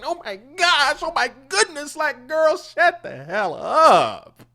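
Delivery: high, loud mocking whine, slowing at the end; shrill mock complainer. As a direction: ham up an outraged valley-girl. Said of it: "talking about".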